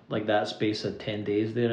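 normal talking voice

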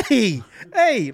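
high, loud mocking hey hey; jeering husband calling out. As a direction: taunt her like the laughing husband filming. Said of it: "So that's him going".

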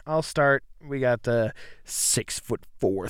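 ordinary talk

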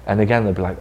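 ordinary talk, nothing marked

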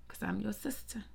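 a hushed sisterly aside; whispered aside. Real, not acted. Sister whispering to Future as his sibling, soft whisper. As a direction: whisper it, close and private.

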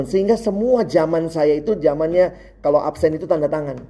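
normal talking voice

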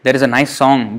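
ordinary delivery

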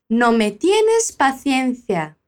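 slow, clear classroom read of the whole line; slow teacher model. Shown as a demonstration. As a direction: spell it out slowly for learners. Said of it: "fica assim, fala".

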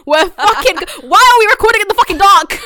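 loud, high-pitched shock; alarmed outburst. Real, not acted. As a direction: yell out in real disbelief. Lights suddenly go off while recording.

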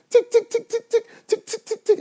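very high clicky tick tick of a pickaxe; high ticking pickaxe noise. Retold or imitated. Dreamer imitating his pickaxe on the diamond, high ticking. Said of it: "it sounds like this".

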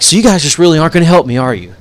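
loud, fast mock-indignant challenge; imitated indignant demand. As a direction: blurt it out like a fed-up customer. Retold customer confronts store employee.

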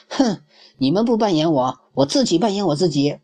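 a touch of indignant shout from the cub; loudly. A narrator's reading. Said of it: "大声说".

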